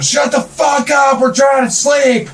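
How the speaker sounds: high, loud, drawn-out shout; imitating an old neighbor screaming to be heard. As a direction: yell down at noisy neighbors like you need sleep. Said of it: "you just scream down".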